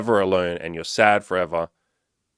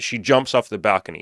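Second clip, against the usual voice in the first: ordinary delivery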